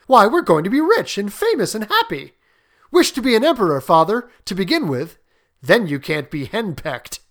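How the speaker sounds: hammed-up excitement, voice lifted; Herbert, pretended horror. Son teasing his father about wishes, mock-earnest. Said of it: "said Herbert with pretended horror".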